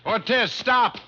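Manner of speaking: high, loud yelled command; shout. Acted. Detective shouting at the fleeing killer, loud high shout. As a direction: yell after him as he runs. Chasing fleeing Ortiz on beach.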